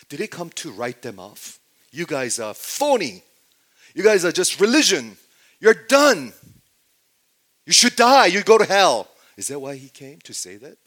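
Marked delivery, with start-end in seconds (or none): 1.9-9.1 loud, high shouted denunciation; harsh condemnation. Acted. Preacher acts out a Jesus writing people off, shouted condemnation. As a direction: escalate into a furious write-off.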